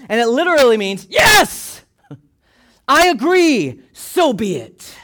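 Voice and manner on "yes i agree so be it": high, loud shout, stretched out slowly; emphatic agreement. Shown as a demonstration. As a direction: shout your wholehearted assent, drawing it out. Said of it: "an emphatic way of voicing your agreement".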